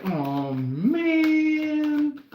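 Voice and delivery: high, drawn-out cartoon groan; cartoony dismay. Caught thief foiled by the gang, cartoony high lament. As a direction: whine like a foiled cartoon villain. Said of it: "cartoony act".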